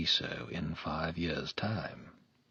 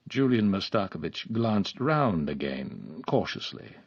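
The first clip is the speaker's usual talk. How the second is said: usual speaking voice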